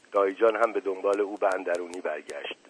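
ordinary delivery, nothing marked

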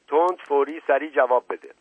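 high, loud interrogator's order; urgent command. A narrator's reading. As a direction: demand an answer on the spot. Inspector demands an instant answer. (دستور بلند و آمرانه)

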